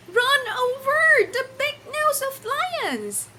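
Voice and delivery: put-on high, loud little kid voice; pretend child answer. Teacher playing a pupil answering her own question, high childlike voice. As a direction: squeak it out like an eager child.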